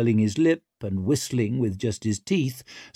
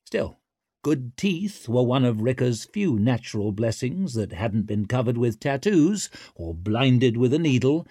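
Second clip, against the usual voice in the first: ordinary delivery, nothing marked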